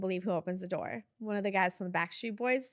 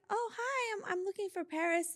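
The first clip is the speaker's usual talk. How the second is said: much higher, a touch softer, starstruck; an assistant, flustered, asks a pop star for Paris; nervous hi; 10 semitones higher, 4 dB quieter than usual. Retold or imitated. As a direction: stammer out the greeting.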